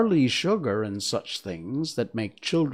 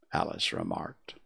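plain reading voice

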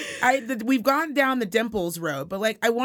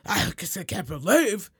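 slow put-on older English accent; mock old English lady voice; slower than usual. Friend retelling an old English lady, in her accent. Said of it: "What accent is that?".

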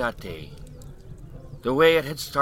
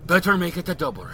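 put-on old Irish drunk; 7 semitones higher, 3 dB louder, faster than usual. Mickey's voice.

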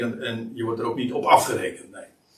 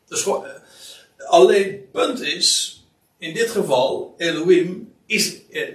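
usual speaking voice

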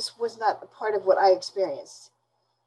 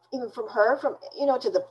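ordinary talk

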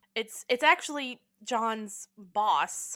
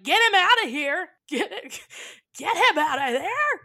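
much higher, louder mock yell; panicked yell; 9 semitones higher, 10 dB louder than usual. John yelling about the toddler scampering in, panicked shout. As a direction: shout to get the kid out. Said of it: "John's like".

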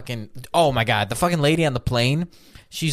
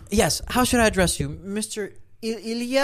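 lightly voiced stewardess, higher pitched; halting, hushed on the name; mock stewardess; 8 semitones higher than usual. Stewardess discreetly asking the passenger his name, in a higher mock voice. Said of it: "the stewardess, and she goes".

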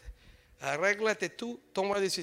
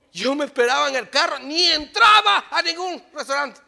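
much louder and higher, near shouting; preaching at full force; 9 semitones higher, 15 dB louder than usual. Real, not acted. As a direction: drive it home from the pulpit. Preaching climax about his childhood.

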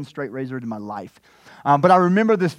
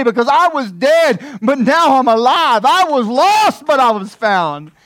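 full-force preaching; 9 semitones higher, 8 dB louder than usual. Acted. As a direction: preach it out, celebrating. Preaching the celebration.

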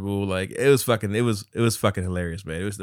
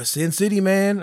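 Carlos impression; 8 semitones higher, 4 dB louder than usual. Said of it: "this is how he sounded".

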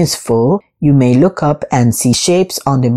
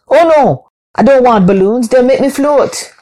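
dismayed cry; 9 semitones higher, 4 dB louder than usual. Acted. Anansi dismayed by balloon prize.

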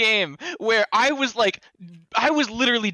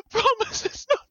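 overacted death scene. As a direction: beg for your life, hammed up. Pleading before being voted out.

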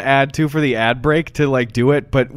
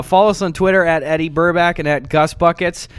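usual speaking voice

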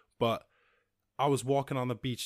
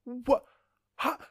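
high, loud stunned What; shocked disbelief; 8 dB louder than usual. Retold or imitated. Retelling seeing a man dump trash, stunned. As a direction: blurt it out in total disbelief. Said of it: "And I was just like".

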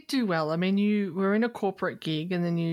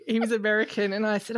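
usual speaking voice

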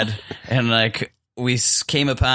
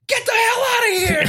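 yelling. Retold or imitated. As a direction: scream it at the animal. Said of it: "my dad yelled".

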